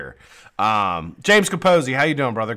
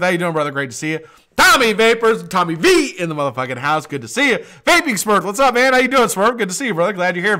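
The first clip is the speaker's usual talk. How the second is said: bright loud shout-out to chat, pitched up; excited greeting; 8 semitones higher, 7 dB louder than usual. Real, not acted. Host hyping up viewers joining live chat, excited.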